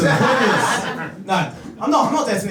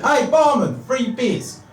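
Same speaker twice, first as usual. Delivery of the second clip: calling out; 8 semitones higher, slower than usual. Acted. Friend calling out to barman.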